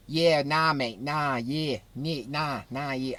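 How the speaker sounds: half-baked imitation of Aussie slang talk; bad Australian accent. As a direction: put on a rough Australian accent. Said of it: "I can't do an Australian accent, but they sound like that".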